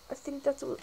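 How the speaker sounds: ordinary talk, nothing marked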